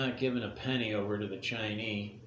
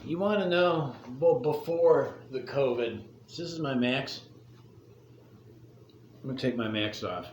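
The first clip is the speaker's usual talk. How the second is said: storyteller voice. Acted. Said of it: "Gather around, kids".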